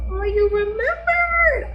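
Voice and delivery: high, slow, squealy delight; delighted surprise. Retold or imitated. Shauna thrilled that Mia remembered the beat, high and slow. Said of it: "Shauna's like".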